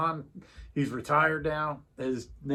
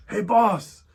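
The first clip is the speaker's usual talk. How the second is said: put-on higher, louder Bosnian-accented call; imitating Bosnian accent; 6 semitones higher, 7 dB louder than usual. Imitating a Bosnian driver calling his boss, Bosnian accent. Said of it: "in his Bosnian accent".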